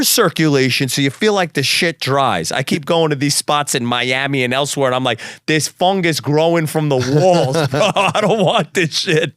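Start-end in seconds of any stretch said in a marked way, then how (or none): 5.5-9.3 slow, drawn-out complaint breaking into laughter; laughing mock disgust. Recalling dirty spots in Miami, laughing mock disgust. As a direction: laugh through exaggerated disgust.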